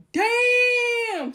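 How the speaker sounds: very high, loud, stretched-out exclamation; hammed-up shock, held long and high; astonished shout. Seeing a surprise Cash App payment, astonished shout.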